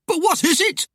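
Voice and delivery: very high, alarmed question; frightened cry. A narrator's reading. Husband asks wife about the apparition, crying out. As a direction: squeal the question in panic. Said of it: "Timmy cried".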